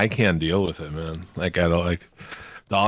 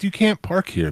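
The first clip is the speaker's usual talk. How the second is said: high, mock-indignant bystander; imitating a bystander; 11 semitones higher than usual. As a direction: squeak out a scolding stranger. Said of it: "be like".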